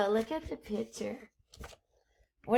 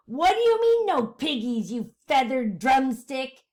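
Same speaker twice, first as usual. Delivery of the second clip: screeching witch; 2 semitones higher, 5 dB louder than usual. Acted. Witch screeching at the chicken. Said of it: "Gritch screeched".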